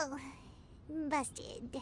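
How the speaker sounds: low, dropped-voice aside; sheepish mutter under the breath; muttered busted. Acted. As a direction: caught out, a low mumble. Caught hiding, muttered aside.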